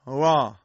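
"را" with the tongue tip free, w-like; hollow r. Shown as a demonstration. Teacher showing the faulty hollow raa, w-like r. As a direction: say "را" with no tongue contact, like Arabic waw. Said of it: "جوفی".

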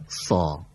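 سا with a full, heavy, back-of-mouth s and a deep aa; thick heavy sa. Shown as a demonstration. Teacher demonstrating heavy سا to a student. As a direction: say سا thick, like a heavy Arabic sad. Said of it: "یه خود درشتر".

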